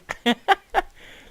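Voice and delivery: loud squealing laugh; high burst of laughter. Real, not acted.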